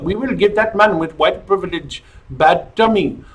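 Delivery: put-on Indian accent, lightly done; imitating an Indian restaurant worker's accent; mock Indian accent. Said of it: "I am a man of many accents".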